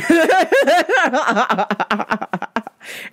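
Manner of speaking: high burst of real laughter. Real, not acted. Laughing after retelling the yelling at the customer. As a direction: crack up at your own story.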